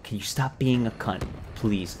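usual speaking voice

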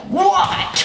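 high, strained comic yell; mad mock yell. Occupant yelling from the toilet seat, mad mock yell. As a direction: yell it high as if annoyed by a knock. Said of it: "to yell that loud at you".

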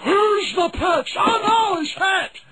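high, loud announcer calls; excited play-by-play. Acted. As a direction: call the action with rising panic.

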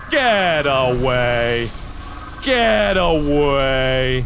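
low, very slow "Get away"; "Get away" dragged out long and low; slow drawn-out plea. Real, not acted. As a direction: stretch out "away" in a low moan. Drawn-out repeats while fleeing.

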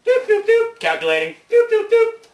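very high loud doops; robot beeps. Acted. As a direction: toy robot computing noises. Robot calculating.

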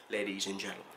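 normal talking voice

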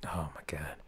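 exasperated whisper. Retold or imitated. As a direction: breathe it out in disbelief. Brother reacts in disbelief.

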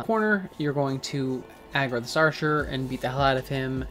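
ordinary delivery

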